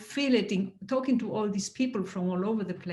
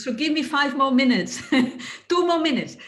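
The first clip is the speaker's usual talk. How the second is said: higher and louder, laughing through rising emotion; choked-up excitement, pitch up and louder; getting emotional; 6 semitones higher, 5 dB louder than usual. Real, not acted. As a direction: emotion welling up, half laughing. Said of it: "I'm getting emotional".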